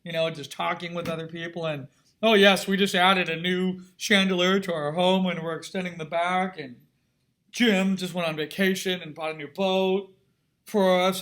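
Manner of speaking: loud, far put-on voice of a rich society lady; hammed-up snooty socialite bragging about her home. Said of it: "that sort of just that Richie voice".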